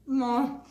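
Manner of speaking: a pleased, savouring hum; loud happy mmm while tasting; mm of delight. Real, not acted. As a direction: hum with pleasure over the bite. Tasting the food on camera.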